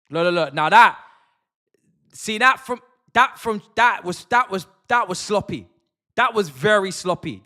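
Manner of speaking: loud, high, outraged yelling at the screen; furious fan yelling at the telly; outraged shout. Real, not acted. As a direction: shout your disbelief at the sloppy play. Outraged at a sloppy play.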